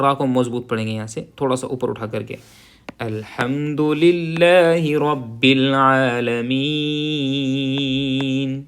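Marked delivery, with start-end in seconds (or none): 3.0-8.6 slow, drawn-out tajweed recitation; Quranic recitation. Shown as a demonstration. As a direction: chant each word slowly. (ٹھہر ٹھہر کر تلاوت)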